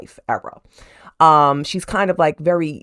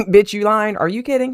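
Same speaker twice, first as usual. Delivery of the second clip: sassy disbelief; 6 semitones higher, 4 dB louder than usual. Retold or imitated. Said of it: "he was like".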